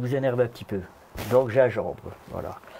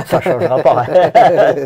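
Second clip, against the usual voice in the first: laughing; 3 semitones higher, 12 dB louder, slower than usual. Real, not acted.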